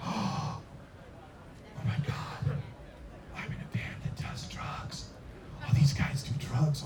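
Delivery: hushed, airless whisper of horror; shocked whisper. Retold or imitated. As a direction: whisper it to yourself in mock shock. Retells his shocked inner reaction.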